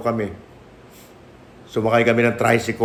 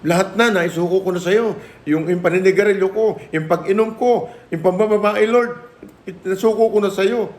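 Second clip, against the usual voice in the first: high, pleading cry to the Lord; pleading prayer; 8 semitones higher than usual. Retold or imitated. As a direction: beg the Lord, voice climbing higher and higher. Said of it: "Sabi ko". (nagmamakaawang dasal, pataas nang pataas ang boses)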